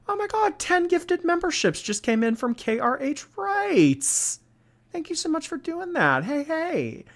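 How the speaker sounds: high-pitched, delighted shout-out; gushing thanks. Real, not acted. Streamer thanks a viewer for ten gifted memberships, gushing. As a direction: thank them in a soaring high voice.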